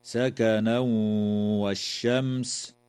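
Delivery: the N of سكنا merged nasally into the W of والشمس; merged nasal tanween; idgham with ghunna. Shown as a demonstration. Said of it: "يدغم التنوين في الواو مع الغنة".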